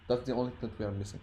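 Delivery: normal talking voice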